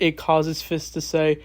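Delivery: usual speaking voice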